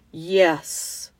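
low, weighty lion voice; great voice. A narrator's reading. Lion answers the girls from behind, low great voice. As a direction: answer deep and grand. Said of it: "said a great voice".

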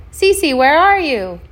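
loud, high, drawn-out call; crying out. A narrator's reading. Dwayne searching the shipwreck for Cece, calling out. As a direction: call through the ship, searching. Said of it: "he cried".

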